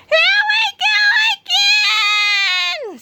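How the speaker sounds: very high, loud wailing scream; a second boy shrieking as they vanish. Acted. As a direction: howl it out in despair. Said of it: "screamed the other".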